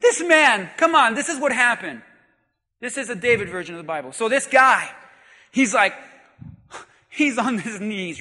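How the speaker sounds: normal talking voice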